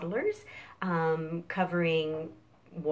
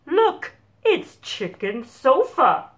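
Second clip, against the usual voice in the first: cows voice; 4 semitones higher, 7 dB louder than usual. Acted. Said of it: "They are saying".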